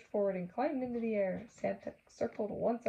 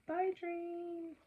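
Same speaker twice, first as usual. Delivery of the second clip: fond farewell; 10 semitones higher, 4 dB quieter than usual. Acted. Waving off the train.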